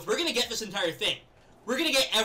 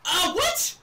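high, loud gasp of shock; shocked shout; 12 semitones higher, 6 dB louder, slower than usual. Real, not acted. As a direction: yelp in disbelief. Shocked at a play.

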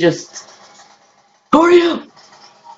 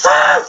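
very high startled shriek; short scared shriek. Real, not acted. Sudden drop on coaster.

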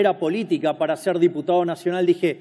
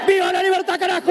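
high rally chant; grito de mitin; 11 semitones higher, faster than usual. Real, not acted. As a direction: chant it to the crowd. (consigna gritada muy aguda)

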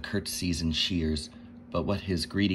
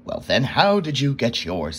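louder, sharp demanding king; a king snapping at a boy for his scarf; 4 semitones higher, 6 dB louder than usual. A narrator's reading. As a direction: snap at the boy like a greedy king. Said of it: "snapped the king".

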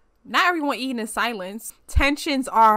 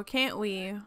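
normal talking voice

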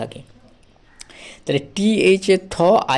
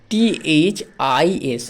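slow spelling; much slower than usual. Shown as a demonstration. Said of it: "TH এর উচ্চারণ হয়ে গেল".

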